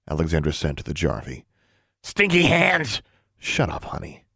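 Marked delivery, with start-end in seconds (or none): 2.0-3.0 high, slow little creature voice; squeaky creature voice. A narrator's reading. Creature Honey insults her. 3.4-4.2 whispered hush; whispering. A narrator's reading. Hushing the creature in the hall.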